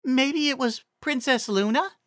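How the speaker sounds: high, dopey pony voice; a hopeful simple guess; high Ditzy guess. A narrator's reading. Ditzy guessing the riddle's answer aloud, high and dopey. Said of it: "Ditzy guessed".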